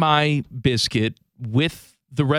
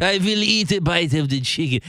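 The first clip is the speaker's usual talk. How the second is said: badly faked French accent; mock French-accented English; horrible French accent. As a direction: do a clumsy, exaggerated French accent. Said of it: "That's my horrible French accent".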